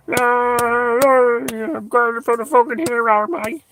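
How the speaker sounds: high, loud put-on English voice; mock pot-banger. Comedian mimicking pot bangers in England, mocking high wail.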